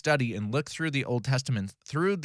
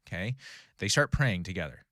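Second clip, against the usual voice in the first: ordinary talk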